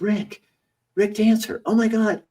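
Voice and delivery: high, eager fake-friendly voice; mock schoolmate all excited to see you; gushing old classmate. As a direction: gush over the TV star. Old bully gushing at grocery store.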